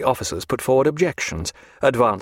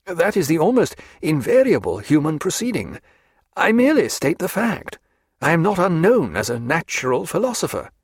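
higher-pitched, precise prisoner; composed Stephen; 6 semitones higher than usual. Acted. Stephen defending his standing as natural philosopher, dignified. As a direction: protest with dignity, pitch lifted.

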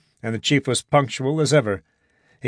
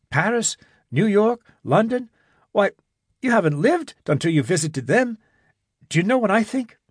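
lightly voiced eager young man, higher; a hint of a youth making plans; young man Jimmy, earnest; 7 semitones higher than usual. Jimmy tells Irene his plan, lightly higher voice.

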